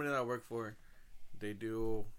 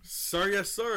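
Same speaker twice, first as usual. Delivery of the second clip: loud gung-ho soldier; 6 semitones higher, 4 dB louder, much slower than usual. Pretend. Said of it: "our newest construction worker".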